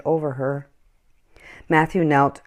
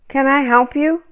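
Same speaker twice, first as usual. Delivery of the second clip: put-on high, eager boy's offer; 11 semitones higher, 4 dB louder than usual. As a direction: offer help like a hopeful child. Boy offers help.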